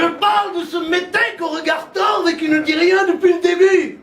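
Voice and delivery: hammed-up furious demagogue; Le Pen impression. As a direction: rant it at the doctor with disdain. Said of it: "Le Pen".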